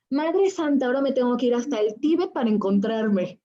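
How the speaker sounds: "Madre Santa" más agudo y fuerte, exclamado con asombro. Retold or imitated. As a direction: asombro teatral al contar la anécdota. Reacción al oír monjes tibetanos. (exclamación de sorpresa, más aguda y fuerte)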